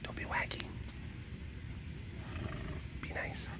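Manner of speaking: soft whisper to the cat; whispering to soothe a wary cat. Real, not acted. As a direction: hush the words, barely voiced. Calming a nervous cat.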